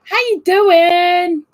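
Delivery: high, slow, drawn-out hello to the viewers; sing-song greeting. Real, not acted. Vlogger greeting her live chat, high and sung out. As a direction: stretch it out like a singer calling to friends.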